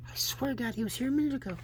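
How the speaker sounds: put-on high, soft child voice; young son insisting. Boy swearing to his dad, high and hushed. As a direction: plead softly like a small boy.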